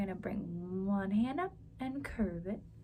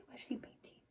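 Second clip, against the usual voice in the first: whisper; 13 dB quieter than usual. Acted. Hushed aside.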